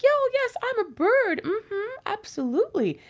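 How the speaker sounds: high, sly voice of a snake posing as a bird; sly snake pretending. Snake calling down from the tree, faking being a bird, high and sly. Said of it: "the snake is calling down like".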